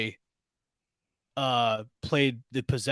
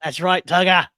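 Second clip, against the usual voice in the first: bad Australian accent, louder; hammed-up Aussie cartoon voice; 5 semitones higher, 6 dB louder than usual. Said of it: "my very bad Australian accent".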